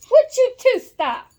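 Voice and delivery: sharp stop-it shout at the pets; exasperated yell. Real, not acted. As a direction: call out to stop the two.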